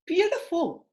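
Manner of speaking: high, delighted beautiful; high praise. Real, not acted. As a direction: praise the pose warmly. Coach praising power pose.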